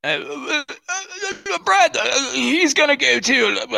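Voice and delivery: hammed-up drunken toff; mock drunk stammer. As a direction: stammer like a tipsy aristocrat.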